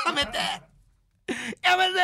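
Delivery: 大きな声で高く「やめて」と懇願; loud pleading. Real, not acted. Begging partner to stop. (四時間喋ろうとする相方を大声で止める)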